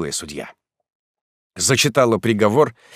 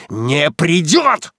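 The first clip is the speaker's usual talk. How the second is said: barked retort; 8 semitones higher, 5 dB louder, much slower than usual. Acted. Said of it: "Рявкую".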